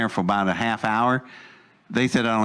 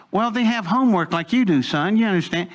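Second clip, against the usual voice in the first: high-pitched, patronizing mimicry; condescending; 7 semitones higher, faster than usual. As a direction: talk down to him like a child. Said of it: "it's very condescending".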